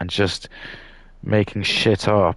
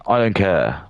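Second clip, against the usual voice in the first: full-voiced, open declaration; firm dismissal; 3 semitones higher than usual. Real, not acted. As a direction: say it out clearly and firmly. Repeats it up at full voice.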